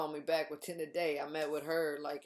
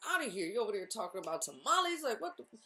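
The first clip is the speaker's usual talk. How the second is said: frantic impatience; 6 semitones higher, faster than usual. Retold or imitated. Agitated, pacing at the doctor's.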